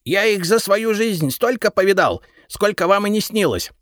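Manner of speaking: изумлённый высокий голос кладовика; искренне удивиться; изумлённо. A narrator's reading. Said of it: "изумился Репей". (удивлённый кладовик)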